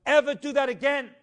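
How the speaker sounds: loud, firm command; stern order. Retold or imitated. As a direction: lay down the law. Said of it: "he says".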